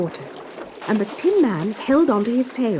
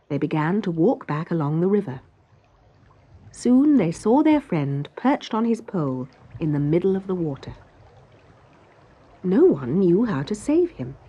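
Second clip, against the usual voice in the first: usual speaking voice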